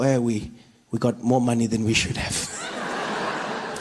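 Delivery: low slow smug official; deep slow self-satisfied clerk. Retold or imitated. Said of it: "They go".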